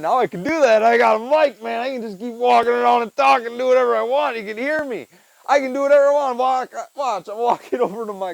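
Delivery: proud, pumped-up announcing; excited. Real, not acted. Shows off his new mic.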